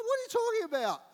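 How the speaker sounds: very high, loud incredulous question; can't believe what he's hearing; shocked disbelief. Retold or imitated. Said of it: "I'm like".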